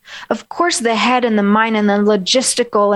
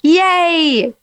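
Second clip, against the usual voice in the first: high bright yay; cheerful yay; 7 semitones higher than usual. Shown as a demonstration. Coach showing the mood shift to cheer. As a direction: cheer it out happily.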